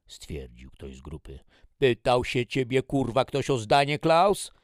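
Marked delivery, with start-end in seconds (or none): 1.8-4.5 loud, high, building anger; angry reprimand. Acted. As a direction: put the soldier in his place. Major rebukes a soldier. (wściekła reprymenda)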